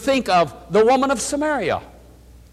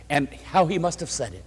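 normal talking voice

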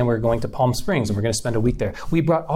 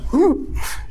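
sharp high gasp. Retold or imitated. Courage leaving in the story.

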